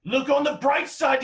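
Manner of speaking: exasperated yelling. Acted. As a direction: shout him down to make him listen. Companion yells back to silence him.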